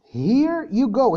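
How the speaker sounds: "Here you go" drawn out slowly; slow demo. Shown as a demonstration. Teacher slowly modelling "Here you go" for the class. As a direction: stretch each word of "Here you go". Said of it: "استخدام here you go".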